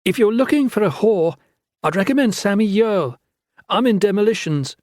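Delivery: high, sharp put-down; cutting retort. A narrator's reading. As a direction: slap him down with scorn. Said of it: "fix him with a glare".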